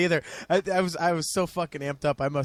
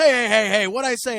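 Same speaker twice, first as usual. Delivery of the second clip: loud, higher scolding voice imitating the security guy; security guard yelling; 6 semitones higher, 7 dB louder than usual. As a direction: bark it like an annoyed bouncer stopping a fan. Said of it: "butts in the middle and goes".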